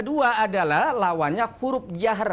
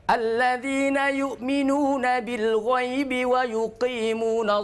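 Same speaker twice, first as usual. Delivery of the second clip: slow chanted recitation, breath held; 2 semitones higher, slower than usual. Shown as a demonstration. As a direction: recite slowly, keeping air in. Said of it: "Benar-benar dijaga nafasnya".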